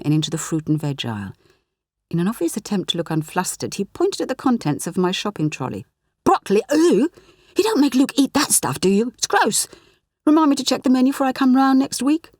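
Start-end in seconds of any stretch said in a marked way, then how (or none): 6.2-12.3 put-on higher, louder teenage boy teasing; cheeky lad mocking the veg; Clyde's voice. A narrator's reading. Clyde teasing Sarah Jane about her broccoli, higher and cheeky.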